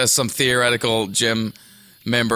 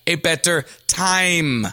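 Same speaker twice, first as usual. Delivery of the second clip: tense, higher-pitched, over-enunciated impression; each phrase set apart with strained precision; John Malkovich; 6 semitones higher than usual. Upset star asking everybody to listen, tensely insistent. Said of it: "tense John Malkovich".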